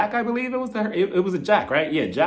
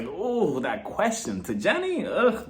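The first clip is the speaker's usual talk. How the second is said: drawn-out, uneasy ooh and ugh; soft, slow wince at an awkward question; cringing reaction; 4 dB quieter, slower than usual. Retold or imitated. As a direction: recoil with a groaned ugh.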